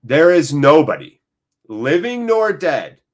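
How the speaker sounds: slow, deliberate phrases with pauses between; emphatic declaration. Real, not acted. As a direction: start loud, then settle down to a measured close. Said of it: "just so everyone understands".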